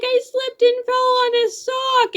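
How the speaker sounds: high, slow, imitated crying whine; imitating tearful answer. Hurt girl telling her parents what happened, imitated crying whine.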